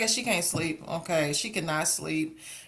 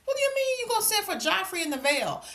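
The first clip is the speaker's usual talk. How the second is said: incredulous outburst; 7 semitones higher than usual. Retold or imitated. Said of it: "I said".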